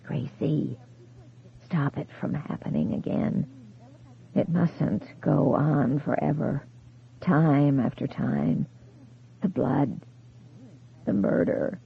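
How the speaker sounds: soft whispered warning, slow and distant; a voice fading from far away; faint ghostly plea. A narrator's reading. Dead husband begs his wife over the phone, ghostly whisper.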